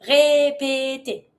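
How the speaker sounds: "Répéter" slowly, three matching syllables; an even é on each beat of "Répéter"; same vowel thrice. Shown as a demonstration. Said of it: "Same sound for those three syllables".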